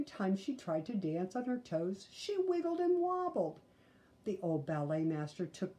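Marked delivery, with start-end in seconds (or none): 2.1-3.6 squeaky high wobble, playful; high wobbly voice. A narrator's reading. Reading to a child, the clumsy dancer in a high wobble. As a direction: let the words wobble up high.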